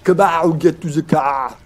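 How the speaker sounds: loud, hammed-up action-hero accent; put-on Arnold-style accent. Gamer urging escape to the car, mock action-hero accent. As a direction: bark it in a mock Austrian accent. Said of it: "So we're swapping accents".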